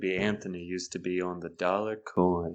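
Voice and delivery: low, soft sultry murmur; sultry narrator. Pretend. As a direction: seductive coin trivia. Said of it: "in a sultry voice".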